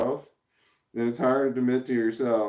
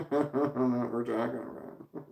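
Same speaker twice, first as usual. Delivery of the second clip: laughing through the line, softer; 4 dB quieter than usual. Real, not acted. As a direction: giggle it out, bemused by chat. Laughing at chat confusion.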